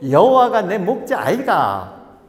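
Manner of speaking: loud, slow, swooping Gyeongsang lilt; hammed-up Gyeongsang scripture reading; Gyeongsang dialect. Pastor reading the psalm's Gyeongsang version, hammed-up dialect. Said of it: "경상도 버전으로 이렇게 얘기한다고 합니다".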